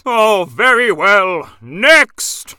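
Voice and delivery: impatient. Acted. Impatient judge calling next.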